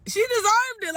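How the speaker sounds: high loud exclamation; excited outburst. Real, not acted. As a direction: blurt it out gleefully.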